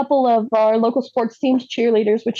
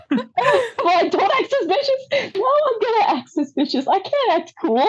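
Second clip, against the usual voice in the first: high, laughing mock panic; 9 semitones higher than usual. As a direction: squeak it out in nervous disbelief. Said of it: "And I was like".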